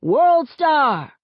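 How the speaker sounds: loud, high-pitched call; shout. A narrator's reading. Onlooker at a school fight, shouting. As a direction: shout it out over the crowd. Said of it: "one fool even shouts".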